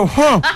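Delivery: high, laughing grunt of effort; strained chewing grunt. Retold or imitated. Storyteller bites a rock-hard arepa, laughing grunt.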